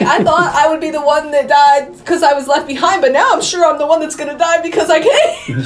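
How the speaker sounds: high, mock-panicked rant; hammed-up freak-out edging into laughter. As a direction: imitate a man working himself into panic. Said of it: "Guy starts freaking out".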